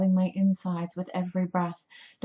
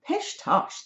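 put-on high, breezy girl's voice; airy dismissal; 11 semitones higher than usual. A narrator's reading. Rescuer dismissing George's worry, airy dismissal. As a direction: brush the worry away in a high voice.

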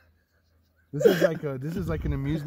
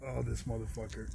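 normal talking voice

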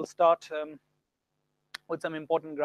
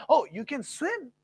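higher, slow surprised "Oh"; 8 semitones higher, 4 dB louder, slower than usual. Shown as a demonstration. Teacher shows surprise, higher and slow. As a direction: stretch "Oh" in surprise. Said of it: "The tone is there".

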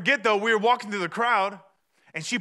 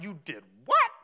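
mock outrage, punching the last word; an imitated incredulous comeback; spouse with attitude; 3 semitones lower than usual. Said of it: "could have given me attitude like".

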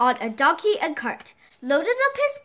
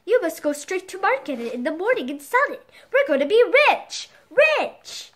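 thrilled; 5 semitones higher than usual. Acted. Wife thrilled by golden egg.

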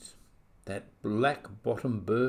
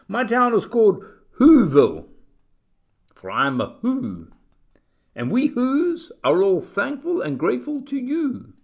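tiny mayor; 10 semitones higher, 8 dB louder than usual. A narrator's reading. Tiny mayor proudly introduces Whoville.

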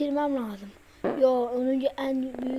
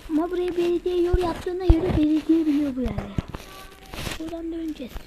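ordinary delivery

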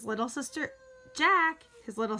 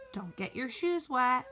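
mom calling a higher-pitched reminder; motherly reminder, pitched up; 5 semitones higher than usual. A narrator's reading. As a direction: lightly call it from another room. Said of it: "called his mom".

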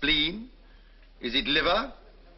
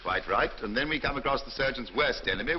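normal talking voice

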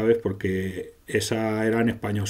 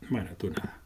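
whispered, deflated aside; telling the idle ally he does nothing, in a whisper; 5 semitones lower, 12 dB quieter, faster than usual. Real, not acted. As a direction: drop it to a whisper, giving up. Aside to useless party member.

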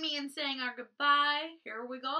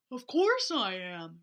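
lower, slow bear reply; 6 semitones lower, 4 dB louder than usual. Acted. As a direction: rumble it out like a bear. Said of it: "replied the bear".

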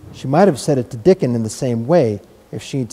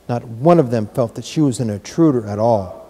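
ordinary delivery

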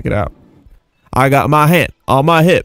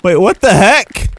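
ordinary talk, nothing marked

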